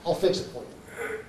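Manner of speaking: soft, nearly whispered reassurance; quiet offer. Retold or imitated. Seller offering to fix a stranger's botched job, quiet offer.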